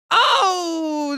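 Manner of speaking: sudden high cry at the play; a quick high excited "oh"; excited shout. Real, not acted. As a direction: yelp as the play lands.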